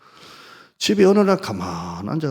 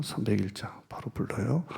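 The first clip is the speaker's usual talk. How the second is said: whispered aside; 5 dB quieter than usual. Real, not acted. Aside to the accompanist.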